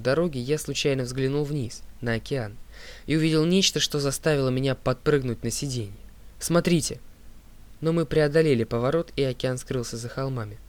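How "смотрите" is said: raised, urgent cry to look; sudden higher-pitched exclamation; startled shout. A narrator's reading. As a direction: call out in sudden alarm. Startled shout at sight of ship.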